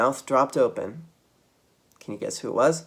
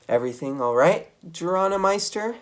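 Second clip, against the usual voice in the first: teasing Thea; 7 semitones higher than usual. Acted. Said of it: "asked Thea".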